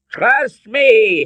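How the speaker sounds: high, urgent shout. A narrator's reading. Father urges son amid yelling, shouting. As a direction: shout it, pitched right up. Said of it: "Henry shouted".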